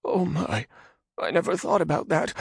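high nervous man voice; frightened Radagon. Acted. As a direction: ask in timid fear. Radagon timid.

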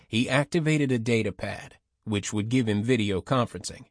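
ordinary delivery

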